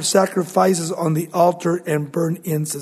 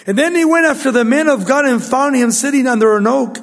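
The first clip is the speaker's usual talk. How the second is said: raised storytelling scripture voice; preaching read; 5 semitones higher, 4 dB louder than usual. Acted. Preacher reading the old prophet's story to the church, raised. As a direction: carry the story with energy.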